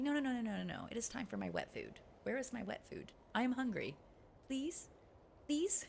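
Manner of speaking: soft, insistent cat, ending in high little pleas; pleading cat voice. Retold or imitated. Cat begging owner for wet food, softly pleading. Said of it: "She's like".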